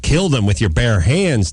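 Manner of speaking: ordinary talk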